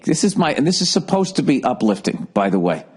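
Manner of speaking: normal talking voice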